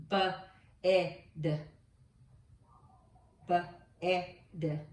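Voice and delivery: B, e, d said sound by sound; each sound of bed apart; segmented sounds. Shown as a demonstration.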